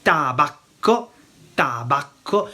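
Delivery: tabacco slowly, "ta-BAK-ko" with a held double C; Italian pronunciation. Shown as a demonstration. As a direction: demonstrate Italian tabacco syllable by syllable. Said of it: "Tobacco in Italian is".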